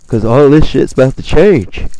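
loud, high mock-prophetic boom; ominous prophecy. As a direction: proclaim it like a doomsday prophet. Retelling a mystic's prophecy.

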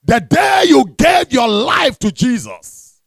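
forceful pulpit proclamation; preaching. Acted. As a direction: drive each phrase home to the congregation.

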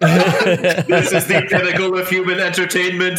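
deadpan mock praise; level, emotionless joke announcement; mock stone-faced. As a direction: declare it with no expression. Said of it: "stone faced".

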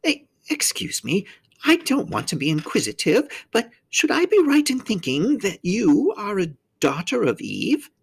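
high-pitched faun character voice; politely tentative, in a high faun voice; polite, hesitant faun. A narrator's reading. Faun asking a strange child what she is, high and hesitant.

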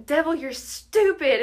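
high, loud, slow mock declaration; fired up. Teller replays her voice memo to the devil, fired up. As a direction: hurl it out in spiritual triumph, half joking. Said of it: "like, fired up".

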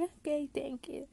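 cliente imitado. Retold or imitated. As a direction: imitate the customer's drawn-out acknowledgment. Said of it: "Y él como que". (cliente imitado, agudo y lento)

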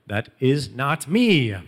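slow, drawn-out "That is not me", landing loud on "me"; emphatic denial. Retold or imitated. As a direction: stretch each word of "That is not me" as a mock disclaimer. Acts out people's emphatic denial.

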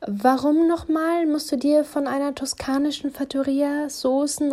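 wife grinning, picking a fight; combative teasing. A narrator's reading. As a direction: tease him, spoiling for a fight. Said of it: "grinste ich ihn kampflustig an".

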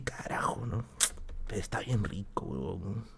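en voz baja, como quien peca a escondidas; susurro tentado. Retold or imitated. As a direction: murmura casi sin voz la tentación. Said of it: "Y entonces dije". (susurrando a escondidas en la casa)

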